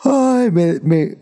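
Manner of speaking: a short high groan while stretching; groaning mid-stretch. Real, not acted. Podcast host stretches mid-episode, groaning high. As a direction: let the words stretch out of you. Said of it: "no, me estiro".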